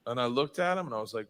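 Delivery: normal talking voice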